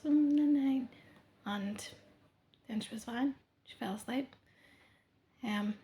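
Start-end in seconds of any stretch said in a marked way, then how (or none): 0.0-0.9 put-on high, drowsy little-child goodnight; sleepy toddler. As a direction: mumble it like a half-asleep toddler. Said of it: "And it was".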